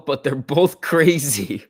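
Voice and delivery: slow words through a laugh. Real, not acted. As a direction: laugh it out, drawn out. Laughing about ex.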